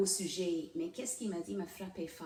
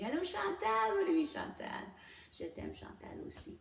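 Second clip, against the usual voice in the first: excited greeting; 12 semitones higher than usual. Real, not acted. Host spotting a viewer joining her live, excited greeting. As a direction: call out a sudden hello, pitched way up.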